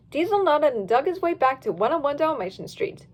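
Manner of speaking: usual speaking voice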